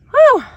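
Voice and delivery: loud whew. Real, not acted. Exhale after hard tilling.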